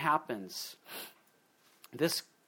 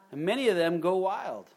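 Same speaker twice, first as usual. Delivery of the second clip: usual speaking voice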